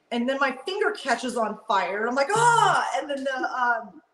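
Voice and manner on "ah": a sharp high startled yelp, louder; quick retold cry of alarm. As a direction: yelp like your finger just caught fire. Finger catches on fire.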